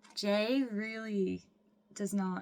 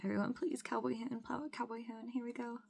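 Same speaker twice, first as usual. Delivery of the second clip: hushed suspense; 2 semitones higher, 7 dB quieter than usual. Real, not acted. Hushed suspense before reveal.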